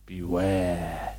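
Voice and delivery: low hushed spooky warning; spooky whisper. Pretend. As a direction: breathe it like a ghost. Spooky horror movie quote.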